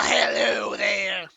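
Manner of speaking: slow, loud rasping zombie; zombie croak. Acted. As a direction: croak it out like a corpse. Voicing rasping zombie greeting.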